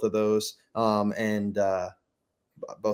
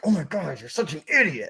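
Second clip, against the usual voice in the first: self-scolding; 6 semitones higher than usual. Retold or imitated. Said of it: "I'll miss my putt and I'll be like".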